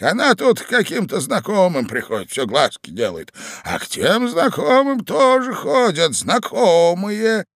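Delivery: put-on high, loud old voice; gossipy old man. A narrator's reading. Old husband gossiping to his wife, high and drawn-out. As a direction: stretch the ending with relish.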